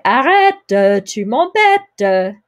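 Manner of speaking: Arrête with a clear final E sound, arrête-uh, loud and insistent; slow, emphatic "Arrête, tu m'embêtes" with the final E voiced; kid's annoyed plea. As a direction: imitate an annoyed little brother: "Arrête" with the E said. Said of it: "the two little brothers ... were fighting".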